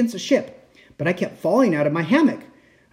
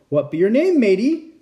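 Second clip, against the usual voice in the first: high, loud pirate asking; gruff friendly pirate captain; 5 semitones higher, 4 dB louder than usual. Acted. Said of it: "the head pirate asked".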